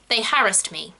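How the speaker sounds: "harassed" stressed on the first syllable, HARRIS-t; RP pronunciation. Shown as a demonstration. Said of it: "In R P".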